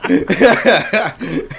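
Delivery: high burst of laughter; laughing. Real, not acted. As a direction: laugh it off loudly. Laughing at own story.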